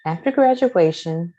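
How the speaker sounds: ordinary delivery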